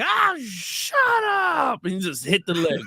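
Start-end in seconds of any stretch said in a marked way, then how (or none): 0.0-1.8 high, very slow mock yell; hammed-up, drawn-out exasperated yell; imitated cartoon yell. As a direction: stretch each word into a cartoon holler. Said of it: "the yelling is so funny".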